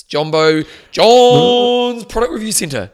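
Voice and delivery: loud, high, drawn-out jingle-like announcement; hammed-up segment announcer. Podcast host introducing John's review segment, big drawn-out announcer call. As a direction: stretch the name out like a ring announcer.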